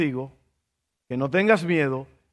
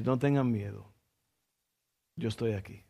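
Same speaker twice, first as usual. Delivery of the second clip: much softer and lower, a hushed reassurance; soft, low, tender reassurance; gentle divine voice; 7 semitones lower, 10 dB quieter than usual. Acted. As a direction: speak as God comforting someone in trials, almost a breath. Voice of God speaking comfort.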